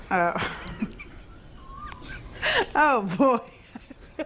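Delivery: breathless giggles; can't stop laughing. Real, not acted. Anchor laughing at banana story.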